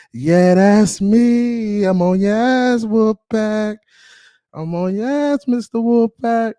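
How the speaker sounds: high, drawn-out gloating taunt. Real, not acted. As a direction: needle the rivals, stretching the words up high. Taunting the Wolf Pack.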